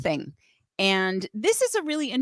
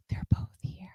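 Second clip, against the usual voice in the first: breathless hushed whisper; excited whisper; 10 dB quieter than usual. Real, not acted. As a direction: gasp then whisper it.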